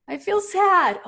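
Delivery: high, loud put-on sad voice; mock sad client. Coach playing a client who admits sadness, high mock-sad voice.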